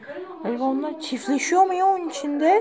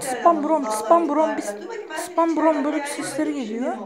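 imitated bird call. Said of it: "Böyle yapıyorlar bak".

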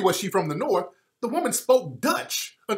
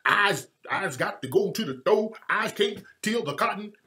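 exaggerated plantation-dialect imitation; sarcastic mock Southern field-hand dialect. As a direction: put on a caricatured plantation talk. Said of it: "with all this plantation talk".

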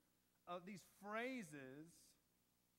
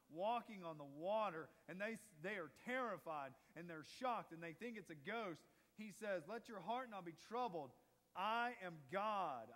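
full-force preaching; 5 dB louder than usual. Acted. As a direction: proclaim it with weight. Preaching Jesus's declaration.

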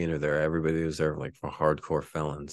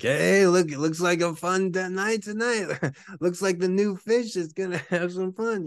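mocking inmates; 13 semitones higher, 4 dB louder than usual. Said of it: "They're like".

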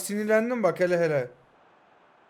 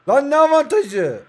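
higher, louder, slowed indignant outburst; furious at a bad call; angry protest; 6 semitones higher, 6 dB louder, slower than usual. Real, not acted. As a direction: bark it at the referee in disbelief. Protesting the referee's advantage call.

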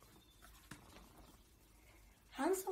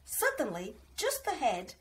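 usual speaking voice

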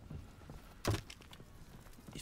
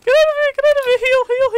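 shrieked high and loud; panicked scream; 13 dB louder, faster than usual. Real, not acted. Player shrieking at an enemy up close. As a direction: scream it in terror. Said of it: "Sorry, I'm screaming like a little girl".

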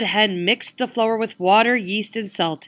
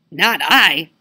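louder, gruff dog bark; 8 dB louder than usual. Acted. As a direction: snap it out like a dog.

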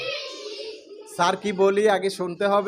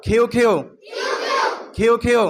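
loud কিউ; 3 semitones higher, 4 dB louder than usual. Shown as a demonstration. Teacher drilling কিউ loudly with the class. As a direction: shout কিউ with a strong kh. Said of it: "আরো জোরে".

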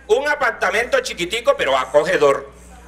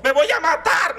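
put-on melodramatic child shout; 9 semitones higher, slower than usual. Child's dramatic tantrum.

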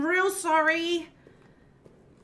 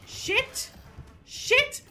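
panicked; 7 semitones higher, 5 dB quieter, much slower than usual. Real, not acted. Panic as enemy appears.

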